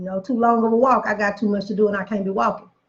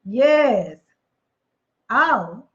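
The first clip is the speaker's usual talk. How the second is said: ordinary delivery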